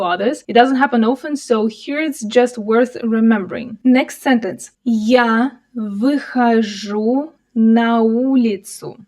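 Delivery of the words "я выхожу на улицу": slow, drawn-out model reading for learners; slower reading. Shown as a demonstration. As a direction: stretch each word out for a learner to follow.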